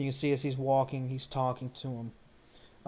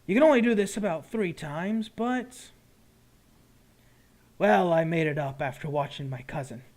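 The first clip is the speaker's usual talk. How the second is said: lightly voiced shopkeeper, starting higher; potion seller; 4 semitones higher, 5 dB louder than usual. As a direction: explain your brew to a customer. Potion seller explains the wares.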